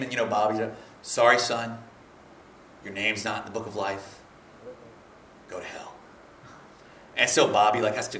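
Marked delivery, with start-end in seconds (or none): 1.0-5.9 low, fading somber judgement voice; stern angel. Retold or imitated. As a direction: pronounce the verdict deep and quiet.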